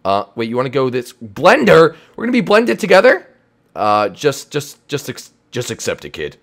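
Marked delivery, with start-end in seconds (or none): 3.8-6.4 much lower gruff showman voice; deep alien voice. Acted. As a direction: drop into a deep gruff character. Alien character.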